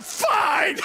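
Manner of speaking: high, loud resigned "Fine."; exasperated shout. Retold or imitated. Preacher giving in to God, shouting "Fine." in exasperation. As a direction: throw up your hands and yell "Fine.". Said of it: "I'm like".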